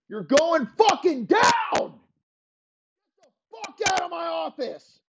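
threat that climbs into a high furious shout; building furious threat. Acted. Elway builds from threat to screaming at Peyton. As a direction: start firm and end screaming.

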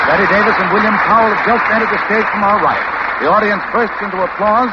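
loud, bright announcer setting the scene; announcer read. Acted. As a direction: call it out to the radio audience. Announcer sets the stage.

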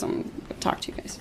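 hushed whisper to the party. Real, not acted. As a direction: whisper it so others won't hear. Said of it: "We could just whisper".

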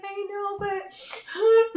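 high, teary voice, close to crying; teary reply. Retold or imitated. Daughter answering mom while crying over the card, teary and high.

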